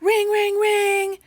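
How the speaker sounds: high, loud mock ringing phone; shrill old-school phone imitation; imitated phone ring. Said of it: "super loud, mean sounding ones".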